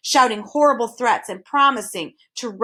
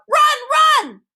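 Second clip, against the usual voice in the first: much higher, loud shout; crying out; 8 dB louder than usual. A narrator's reading. Hidden voice urging the boys to flee, loud shout. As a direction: yell it urgently from afar. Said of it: "a voice cried out".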